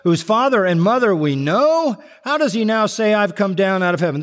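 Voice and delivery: retold jeering of the doubters; mocking scorn. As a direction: ridicule him lightly, a touch of scorn. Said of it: "This is scorn, this is mockery".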